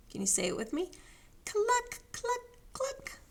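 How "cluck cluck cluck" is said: high, separate hen clucks; high-pitched chicken call; clucking hens. Pretend. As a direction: lead children in clucking like hens. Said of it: "the hens cried".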